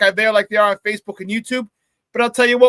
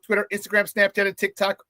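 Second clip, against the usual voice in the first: ordinary talk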